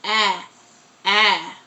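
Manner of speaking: short "Ah" vowel sound repeated; sounding out words. Shown as a demonstration. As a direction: say "ah" as the short a in cat. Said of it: "they start to build the word".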